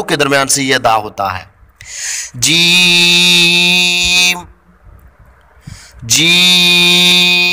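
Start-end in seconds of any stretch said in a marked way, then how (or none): 2.4-7.5 जीम held very long, higher and loud; drawn-out jeem. Shown as a demonstration. Teacher modelling जीम slowly for students. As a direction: stretch जीम out like a chant. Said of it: "ज़ुबान के दरमियान और तालु".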